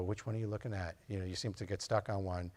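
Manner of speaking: soft, quiet question to the patient; gentle lean-in. Retold or imitated. Doctor leaning in to ask his patient, quiet and soft.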